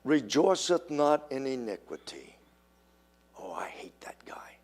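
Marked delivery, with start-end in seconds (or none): 3.4-4.5 whispered spite, no voice at all; a secret, unvoiced whisper of dislike; hushed gossip. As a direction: lean in and hiss it like gossip. Gossiping church member voiced.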